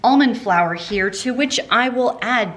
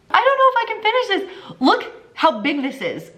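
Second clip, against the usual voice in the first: laughing; 13 semitones higher than usual. Real, not acted. Amazed laugh at huge bowl.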